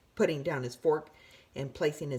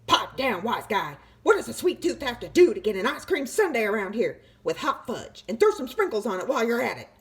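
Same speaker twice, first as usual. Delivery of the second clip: louder, fast demanding; pushy sweet tooth; 6 semitones higher, 6 dB louder than usual. A narrator's reading. Sweet tooth ordering a sundae, loud and pushy. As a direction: rattle off a greedy order.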